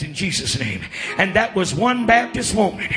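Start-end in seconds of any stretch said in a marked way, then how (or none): 1.2-2.9 loud, high revival shouting, building; full-force preaching. Real, not acted. Preacher calling the church to shake the country, full-force shouting. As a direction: whip the congregation up, louder and higher.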